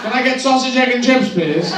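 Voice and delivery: high, loud order for a fry-up; loud English tourist. Acted. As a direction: bark the order like a Brit abroad.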